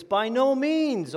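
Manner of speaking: high, slow and emphatic on no means; emphatic answer. Retold or imitated. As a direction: hammer the denial slowly and firmly. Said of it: "he has a very emphatic answer".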